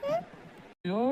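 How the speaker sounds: a high, soft, broken wail of grief; tearful wail. Acted. Sister seeing her off, wailing high and soft. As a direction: let the cry crack up high as she leaves.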